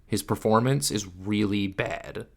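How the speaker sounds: normal talking voice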